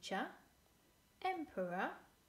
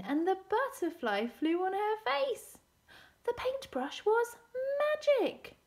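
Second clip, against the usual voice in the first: excited storyteller climbing to a very high, loud reveal; amazed reveal; 12 semitones higher, 10 dB louder than usual. A narrator's reading. Storyteller revealing the magic paintbrush to children, amazed. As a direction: build higher and louder in wonder.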